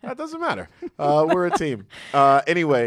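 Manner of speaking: high, loud teasing squeal; playful squeal. Acted. Teasing credit squabble.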